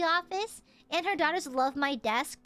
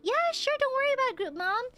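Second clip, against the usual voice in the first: cheery reply; 9 semitones higher, faster than usual. Retold or imitated. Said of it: "I'm like".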